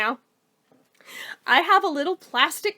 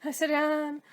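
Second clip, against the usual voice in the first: ordinary delivery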